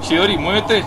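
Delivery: loud, high urging call; urgent shout. Real, not acted. Player urging Chidori on, loud and high. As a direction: yell at the character to hurry.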